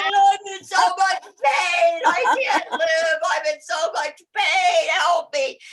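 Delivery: high, loud, drawn-out mock wail; crying, screaming for help. Patient waking from morphine in pain, screaming for help. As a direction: wail it slowly, acting out your own agony. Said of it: "crying and screaming for help".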